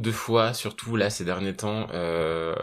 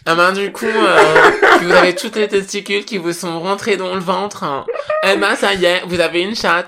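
high, loud tutorial voice breaking into laughter; mock influencer; 9 semitones higher, 10 dB louder than usual. Joining the parody of an influencer tutorial, loud and laughing. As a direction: ham up the beauty-tutorial host.

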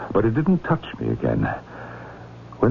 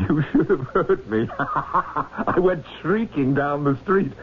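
higher, laughing through the words; laughing while telling; 8 semitones higher, slower than usual. Retold or imitated. Professor recounting his giddy relief to the priest, laughing. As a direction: let the relief bubble into laughter. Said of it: "I laughed aloud".

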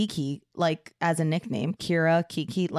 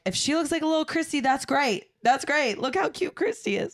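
excited quote; 8 semitones higher than usual. Retold or imitated. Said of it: "we were like".

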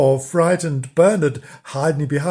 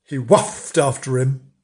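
usual speaking voice

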